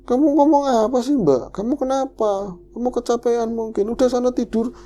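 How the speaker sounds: high-pitched exasperated questioning; dismissive elder, retold. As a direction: act an elder sending her to bed. Elder dismisses her, sends to bed. (menegur heran, nada tinggi)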